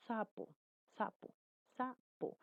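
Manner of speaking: "sapo" softly, with a strong hissed "s"; voiceless S demonstration. Shown as a demonstration. Teacher testing a voiceless consonant, soft demonstration of "sapo". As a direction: model "sapo" quietly as separated words. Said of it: "la S que tiene un sonido ya casi fuerte".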